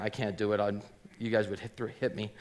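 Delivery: ordinary talk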